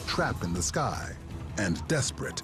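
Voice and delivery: ordinary delivery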